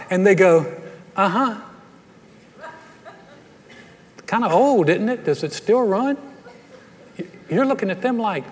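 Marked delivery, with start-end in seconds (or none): 1.1-6.2 unimpressed friend. Acted.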